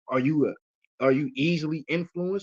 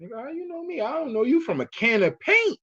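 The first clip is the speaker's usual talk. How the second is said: disbelief; 10 semitones higher than usual. Retold or imitated. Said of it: "I'm like".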